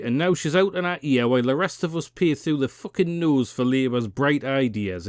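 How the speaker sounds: ordinary talk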